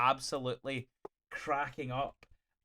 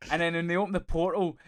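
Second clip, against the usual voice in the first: normal talking voice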